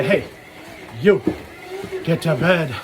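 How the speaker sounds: ordinary talk, nothing marked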